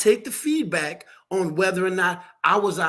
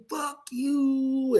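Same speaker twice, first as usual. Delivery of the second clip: imitating a cussing young girl, pitched up; a touch of the girl's screaming curse; mock angry cussing; 6 semitones higher than usual. Retelling how the young woman cursed him out, mock angry. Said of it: "she started cussing me".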